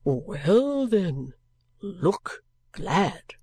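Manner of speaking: drawn-out character voice with swooping tune; eccentric gentleman. Acted. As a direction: command it as an odd gentleman.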